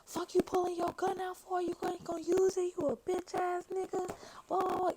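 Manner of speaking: high-pitched mock yell, friend from New York; imitating a yell. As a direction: pitch way up, throwing the words at him. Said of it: "fucking yelling out like".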